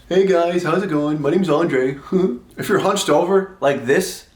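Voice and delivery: hunched-over slouch. Pretend. Showing viewers a hunched-over guy saying hello, mock slouched voice. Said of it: "when you're hunched over like this".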